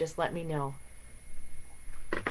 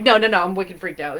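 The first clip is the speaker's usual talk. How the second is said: mock freaked out; 8 dB louder, faster than usual. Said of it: "and I was like".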